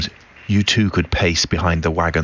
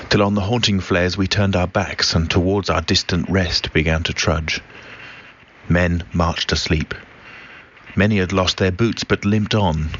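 plain reading voice

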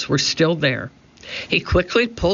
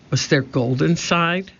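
ordinary delivery, nothing marked

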